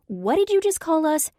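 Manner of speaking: high, loud offended question; indignant question. A narrator's reading. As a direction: demand an answer, offended. Offended by the insult.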